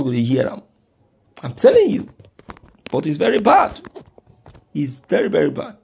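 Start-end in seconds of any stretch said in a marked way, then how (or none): 1.4-5.8 very high, loud cry; impassioned. Real, not acted. Impassioned peak of the rant.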